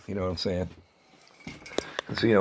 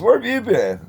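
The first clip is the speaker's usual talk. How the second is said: high, louder mock greeting; imitating the gas station guy; 12 semitones higher, 5 dB louder than usual. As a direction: play the surprised clerk, pitched high. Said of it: "he goes".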